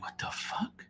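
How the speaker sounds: breathy whispered "what the fuck"; whispered shock. Retold or imitated. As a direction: hiss it under your breath in disbelief. Hears dog peeing, reacts.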